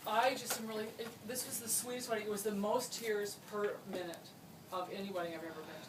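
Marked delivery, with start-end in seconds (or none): none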